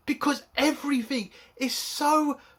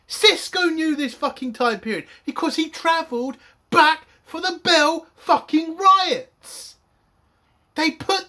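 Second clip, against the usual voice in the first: loud, higher, last words dragged out in rage; angry shout; 7 semitones higher, 7 dB louder than usual. Real, not acted. Reviewer raging that Sisko knew this period, loud angry shout. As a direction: hammer home the fact in fury. Ranting about show canon.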